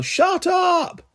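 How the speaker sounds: shrill, high shout. A narrator's reading. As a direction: yell it to silence them. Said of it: "I yell".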